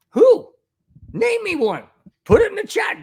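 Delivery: high, loud, exasperated demand; daring the viewers to name one; angry challenge. Real, not acted. Fan challenging his chat, loud and high exasperated.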